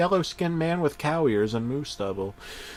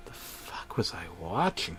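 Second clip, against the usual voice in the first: hushed, baffled aside; disbelieving whisper; 5 semitones lower, 12 dB quieter than usual. Acted. Narrator whispering shock at what he's watching. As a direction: whisper it under your breath, stunned.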